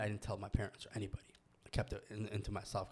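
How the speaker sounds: fast, hushed whisper; quick whispered confession; confiding whisper. Real, not acted. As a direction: let it slip out quietly like a secret. Confessing he kept it secret.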